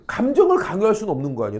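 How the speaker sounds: usual speaking voice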